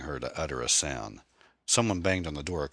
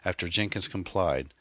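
ordinary delivery

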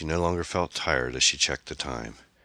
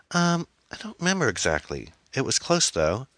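stammering; 10 semitones higher than usual. A narrator's reading. Daughter fumbles for the score to mother, stammering. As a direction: falter through a shaky excuse as a teen. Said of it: "she stammered".

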